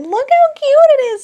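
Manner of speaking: high squeal of delight; gushing over something cute; excited. Real, not acted. Showing off a tiny deck.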